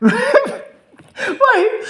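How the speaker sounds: high burst of laughter into why; laughing. Real, not acted. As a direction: crack up mid rant. Laughing mid rant.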